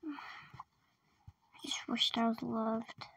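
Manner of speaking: slow, soft whispered wish; a lonely child whispering a wish; sad whisper. Acted. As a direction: whisper it to yourself, slowly.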